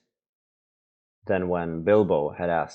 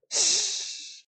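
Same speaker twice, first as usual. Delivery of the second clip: high mock hiss of a creature; 5 dB quieter than usual. Gollum hissing at Bilbo's question, mock hiss. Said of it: "hissed Gollum".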